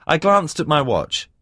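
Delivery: ordinary delivery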